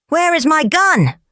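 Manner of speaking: high-pitched, insistent demand. Acted. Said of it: "demanded the soldier".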